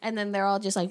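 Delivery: ordinary talk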